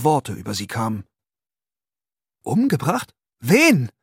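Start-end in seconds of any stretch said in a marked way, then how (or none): 2.4-3.9 higher, louder cry of disbelief; cried out. A narrator's reading. Accused man crying out at the murder charge. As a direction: blurt it out in shock. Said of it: "rief er".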